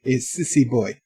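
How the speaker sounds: softly put-on French accent; light joke French accent; mock French accent. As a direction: say it as if in French, quietly. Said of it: "as they say in French".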